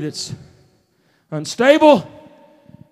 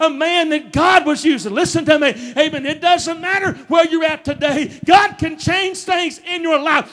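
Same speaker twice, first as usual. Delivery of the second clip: raised, insistent sermon cadence; full-force preaching; 5 semitones higher than usual. Acted. Preacher urging church that God changes lives, full-force preaching. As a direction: drive each phrase out to the congregation.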